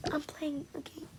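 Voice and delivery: whispered "I'm playing a game" very softly. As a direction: breathe it out secretly under the breath. Line repeated in two voices.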